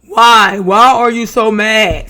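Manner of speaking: slow, loud, exasperated questioning; acted exasperated demand. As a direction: demand an answer, loudly and slowly. Reads Dom's demanding reply aloud.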